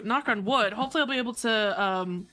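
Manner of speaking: usual speaking voice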